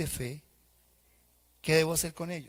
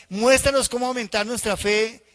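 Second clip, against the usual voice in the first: higher, louder plea; pleading apostles; 6 semitones higher, 8 dB louder than usual. Acted. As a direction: beg for more faith. (súplica alta y fuerte de los apóstoles)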